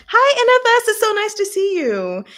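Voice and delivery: high, loud happy hello; light up greeting a friend; excited greeting. Real, not acted. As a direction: streamer happily greeting a viewer who just joined. Greeting viewer in live chat.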